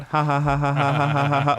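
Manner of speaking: imitated nonstop cackle; laughing her ass off. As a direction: mimic someone laughing helplessly in the background. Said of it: "laughing her ass off".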